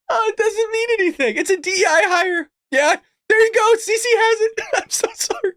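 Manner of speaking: high, giggly strained voice; breaking into laughs while apologising; still cracking up. Real, not acted. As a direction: talk through leftover laughter, pitched high.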